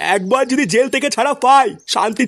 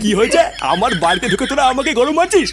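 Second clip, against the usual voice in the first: ordinary delivery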